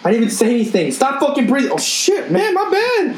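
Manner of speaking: high, laughing put-on voice; mock squabble. Friends acting out a pregnant wife's outburst, high laughing voice. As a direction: squeak it out through a laugh.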